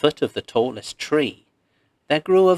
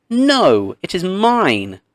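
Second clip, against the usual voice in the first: higher and louder, firm denial; angry retort; 7 semitones higher, 6 dB louder, slower than usual. A narrator's reading. The other man snapping back in the argument, loud high retort. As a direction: shout him down. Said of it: "began to fight and argue".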